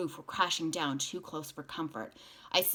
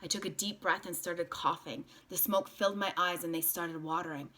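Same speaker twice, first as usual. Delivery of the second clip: usual speaking voice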